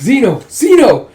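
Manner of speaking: high, loud cry of his name, rising in urgency; alarmed shout. Retold or imitated. Friend seeing Zeno's chest pierced, alarmed high shout. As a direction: call out in alarm to a wounded friend.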